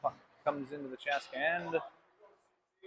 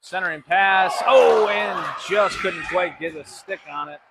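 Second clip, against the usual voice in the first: excited call; 4 semitones higher, 10 dB louder than usual. Real, not acted. Near goal during the play-by-play.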